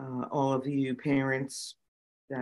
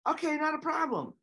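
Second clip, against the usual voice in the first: okay, not a problem in a much higher bright voice; mock cheery okay. Telling how she agreed to the setup, high-pitched chirp. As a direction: chirp it way up high, playing along. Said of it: "I'm like".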